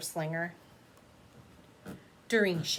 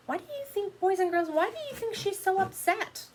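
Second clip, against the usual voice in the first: teacher question; 11 semitones higher than usual. Real, not acted. Teacher asking the boys and girls, high and bright.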